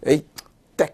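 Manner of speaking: playful scolding exclamation; mock telling-off as a memory trick; mock cursing. Shown as a demonstration. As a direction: demonstrate a pretend curse in two short bursts. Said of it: "이렇게 욕을 해".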